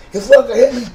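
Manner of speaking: high, stunned, whining voice; mock dazed victim, high and pleading; dazed whiny repeat. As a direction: whine it like someone just knocked out. Dazed roommate again.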